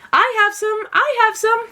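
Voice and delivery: high, eager calls of offering; excited cry. A narrator's reading. Villagers to the monks, crying out high and eager. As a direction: shout it out, keen to give. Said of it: "the people cried out".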